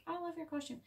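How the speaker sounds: put-on high, soft chirpy girl voice; chirpy girlfriend. Girlfriend greeting the killer, high and soft. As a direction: gush sweetly again, same high lilt. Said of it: "she's like".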